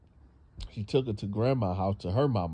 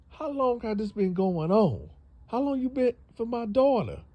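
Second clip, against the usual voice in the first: shrill interrogation; 12 semitones higher than usual. Retold or imitated. Mother interrogates boyfriend.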